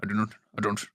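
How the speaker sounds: low, soft wizard impression; Gandalf. Wizard admitting he is lost in the mines, low musing. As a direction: murmur it low like a puzzled old sage.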